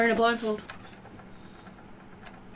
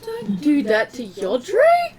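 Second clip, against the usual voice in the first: pleading, slow and much higher; half-joking anguished protest for a friend; mock distress; 7 semitones higher than usual. Protesting harm to beloved character.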